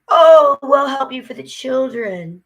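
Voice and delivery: high, loud mock sweetness; mocking agency voice. Mocking how agencies favor parents, in a high loud coo. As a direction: coo it like a fake-kind caseworker.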